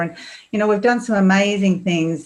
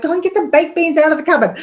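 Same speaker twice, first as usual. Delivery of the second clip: exasperated mum; 9 semitones higher, faster than usual. Retold or imitated. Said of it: "it's like".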